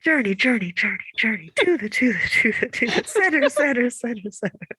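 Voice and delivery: mock echo, repeating and fading away; echo effect. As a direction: voice your own echo, dying off at the end. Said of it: "a clip of you saying".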